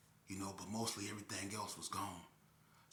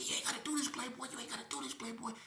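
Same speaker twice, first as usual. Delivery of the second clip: much higher pleading voice, repeated; begging; faster than usual. Retold or imitated. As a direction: beg him to stop. Victim begging while pistol-whipped.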